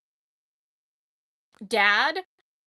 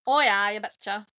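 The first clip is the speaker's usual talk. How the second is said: Minnesotan accent with a long, louder "Oh"; classic Minnesotan accent; 4 dB louder than usual. Shown as a demonstration. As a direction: do a stock Minnesotan accent, drawing out the opening. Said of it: "That's classic Minnesotan".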